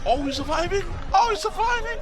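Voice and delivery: high, loud cheer building up; excited cheering. Real, not acted. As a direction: yell it out as if cheering a fighter on. Cheering on fish video.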